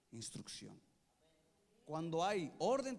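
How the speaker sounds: usual speaking voice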